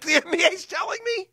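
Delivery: ordinary talk, nothing marked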